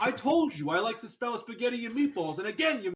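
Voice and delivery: high, indignant mock-Italian accent; Italian mafioso ghost. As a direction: complain like a hammed-up New York Italian. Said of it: "like mafioso ghosts".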